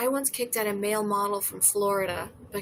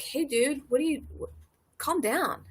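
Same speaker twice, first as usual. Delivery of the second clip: bartender calming; 4 semitones higher than usual. Retold or imitated. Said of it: "the bartender was like".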